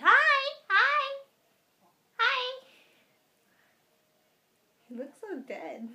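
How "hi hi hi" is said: very high squeaky dog voice. Pretend. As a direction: voice the dog saying hi. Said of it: "my dog is saying".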